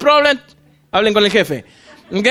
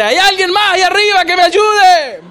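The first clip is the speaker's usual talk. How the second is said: acted call upward; 8 semitones higher, 4 dB louder than usual. Hanging man seeks another rescuer above, shouting. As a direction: call out for someone else's help. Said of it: "levanta la cabeza y dice".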